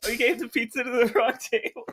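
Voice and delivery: high tearful whine breaking into a laugh; mock crying. Waitress explaining her pizza mistake to a friend, mock crying. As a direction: imitate a weepy confession, pitched high. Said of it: "like, crying and stuff".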